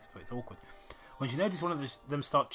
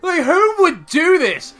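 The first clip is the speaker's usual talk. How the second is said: very high, loud and slow; loud stretched disbelief; outraged; 17 dB louder, slower than usual. Real, not acted. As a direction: demand it in disbelief. Outraged at the bullies.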